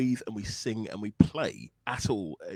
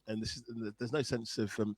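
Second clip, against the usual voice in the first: normal talking voice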